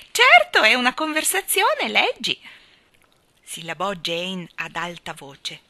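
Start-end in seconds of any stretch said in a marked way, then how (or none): 0.1-2.4 high, loud and merry; amused exclamation. A narrator's reading. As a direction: exclaim it fresh from laughing. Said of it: "scoppiò in una risata". (divertita, acuta e squillante)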